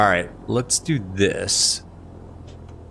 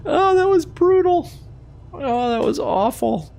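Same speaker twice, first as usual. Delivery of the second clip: high, loud, half-crying wail over a botched landing; anguished wail; 3 dB louder than usual. Real, not acted. Pilot wails to viewers over his terrible landing, high and crying. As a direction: wail it out like you just wrecked your plane. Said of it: "I just wanna hang my head and cry".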